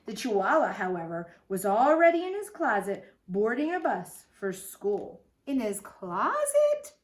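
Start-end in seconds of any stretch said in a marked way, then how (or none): 5.5-7.0 slow, raised questioning tone; puzzled question. Acted. Teacher wonders at the bus in a closet, puzzled. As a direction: draw it out with a rising pitch. Reader queries the story for children.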